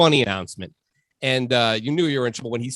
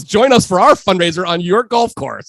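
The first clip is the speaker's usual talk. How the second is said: loud, higher imitation of an announcer; 4 semitones higher, 8 dB louder than usual. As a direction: boom it out like a stadium announcer. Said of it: "So he goes".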